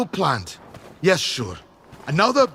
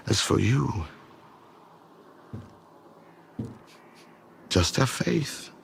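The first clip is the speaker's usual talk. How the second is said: mystic recital; 10 semitones lower than usual. Acted. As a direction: intone it like a prophecy. Cryptic mystical speech.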